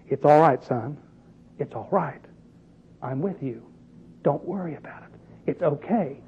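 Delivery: soft, low, tender father's voice; reassuring. Acted. Father comforting his suffering son, soft and reassuring.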